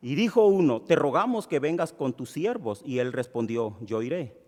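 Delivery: measured Bible reading to the congregation; scripture reading. Acted. Pastor reading the passage to the church, steady reading. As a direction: read the verses out steadily for all.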